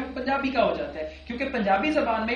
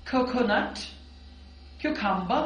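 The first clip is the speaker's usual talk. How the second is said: clear drill words; much slower than usual. Shown as a demonstration. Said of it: "मेरे साथ repeat कीजिएगा".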